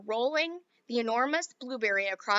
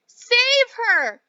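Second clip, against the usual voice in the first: high, loud, slow scream; mother screams as Violet turns blue; 12 semitones higher, 12 dB louder than usual. Acted. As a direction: draw the scream out in horror. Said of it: "cried Missus Beauregard".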